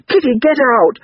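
high, loud wail of protest; wailing boy. A narrator's reading. Crying boy sending his sister away, wailing. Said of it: "he wailed".

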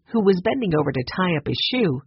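plain reading voice

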